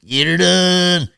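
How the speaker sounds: loud, high, long-drawn holler; slow, stretched catchphrase yell; catchphrase on request. Acted. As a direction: belt out your catchphrase to prove it's you. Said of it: "Let me hear you say".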